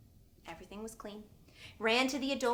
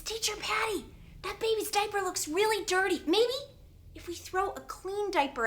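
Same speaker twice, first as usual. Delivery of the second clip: put-on high-pitched child offering advice; little boy; 10 semitones higher, 4 dB louder than usual. Noah coming up to his teacher with an idea, childlike. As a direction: pipe up like a small boy.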